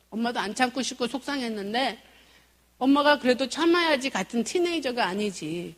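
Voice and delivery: ordinary talk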